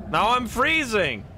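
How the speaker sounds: high, drawn-out exclamation; exasperated whine. Real, not acted. Player stuck freezing by a stalled character, exasperated whine. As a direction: wail the complaint slowly, pitch jumping up. Complaining at the broken game character.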